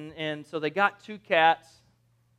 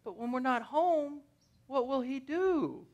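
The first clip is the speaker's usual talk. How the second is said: playful pitying voice; mock concern; 6 semitones higher, 8 dB quieter than usual. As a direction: fret sweetly over a pet. Owners fretting over lonely kitten.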